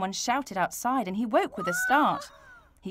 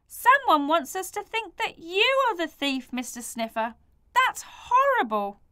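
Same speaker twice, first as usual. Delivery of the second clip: outraged; 8 semitones higher, 4 dB louder than usual. Acted. Bert outraged on Mister Sniffer's behalf. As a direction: spit out the injustice. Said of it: "He was outraged".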